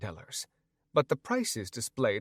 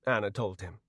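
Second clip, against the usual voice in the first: usual speaking voice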